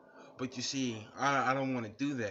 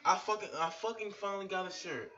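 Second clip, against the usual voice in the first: mock excited reveal; 7 semitones higher, faster than usual.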